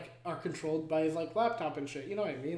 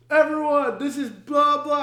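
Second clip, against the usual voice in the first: loud distress call; 8 semitones higher, 11 dB louder, slower than usual. Retold or imitated. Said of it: "he goes".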